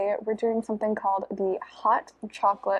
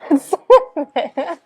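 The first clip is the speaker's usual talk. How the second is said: real laugh running into the words; amused, laughing reaction to a taste; 6 semitones higher, 7 dB louder, slower than usual. Real, not acted. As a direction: say it through laughter, pitched up and louder.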